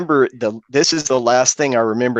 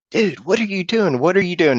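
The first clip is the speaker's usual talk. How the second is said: imitated yelling; 7 semitones higher than usual. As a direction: imitate a friend's alarmed yell without volume. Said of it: "he is yelling at me".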